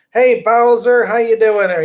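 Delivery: calling out. Real, not acted. Host calling out hello to viewer Bowser. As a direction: hail him like he's across the room.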